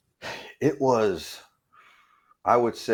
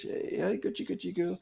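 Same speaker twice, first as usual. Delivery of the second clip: put-on baby talk; 8 semitones higher, 6 dB quieter than usual. Cooing to tiger again.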